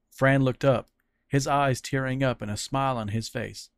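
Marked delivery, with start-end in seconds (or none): none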